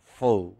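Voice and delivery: "pho" said as one clear stressed syllable; stress on first syllable. Shown as a demonstration. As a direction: land firmly on "pho". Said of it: "It has stress on the first syllable".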